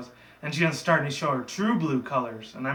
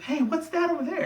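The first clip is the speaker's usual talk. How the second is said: high-pitched cartoon pony voice; mock distraction call. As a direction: point something out in a high voice.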